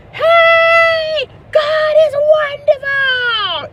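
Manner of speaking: high, loud, slow preacher shout; hollering preacher. Retold or imitated. Preacher shouting to the Sunday congregation, loud sermon yell. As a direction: bellow it from the pulpit. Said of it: "the preacher's going".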